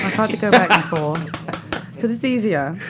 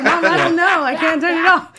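higher, laughing through the words; laughing helplessly; 10 semitones higher, 6 dB louder than usual. Retold or imitated. Telling her mom she can't stop, laughing. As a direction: giggle out a helpless excuse. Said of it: "And I'm like".

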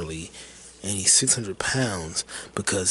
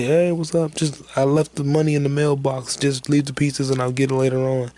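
high, raised voice imitating an unseen customer; louder, higher retold reply from indoors; calling back; 6 semitones higher, 5 dB louder than usual. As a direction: call instructions out to the courier.

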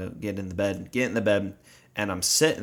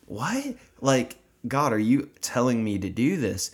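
stunned disbelief; 3 semitones higher than usual. Retold or imitated. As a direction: gasp out the question in shock. Stunned by the angel's message.

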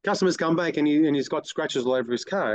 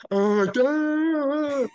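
high, hammed-up dopey "oh, duh"; sneering parody of a dim reaction; mock dumb voice; 11 semitones higher than usual. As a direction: play the clueless guy, mocking him. Mocking staff's dumb reaction.

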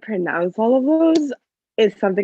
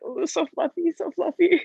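high, cooing mock self-soothing; self-soothing gush; 11 semitones higher than usual. Fan imitating Jesper calming himself with Milo, high self-soothing coo. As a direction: coo it high, imitating someone cuddling for comfort. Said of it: "trying to, like, calm himself. He's like".

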